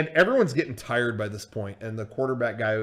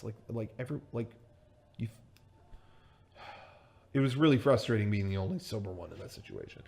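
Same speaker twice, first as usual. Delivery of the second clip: ordinary delivery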